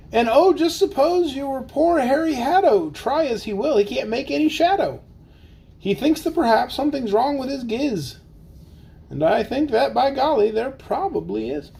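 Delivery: lively, sing-song storybook reading; bouncy picture-book narration; reading to children. Acted. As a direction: read the rhyme aloud for the kids. Reads Seuss aloud to kids.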